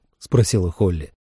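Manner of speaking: ordinary delivery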